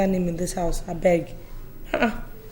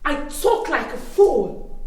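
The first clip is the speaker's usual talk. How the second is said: higher, affronted questioning; indignant disbelief; 6 semitones higher than usual. Acted. Host called a fool by her friend, indignant. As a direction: pitch it up in offended disbelief. Insulted in her own house.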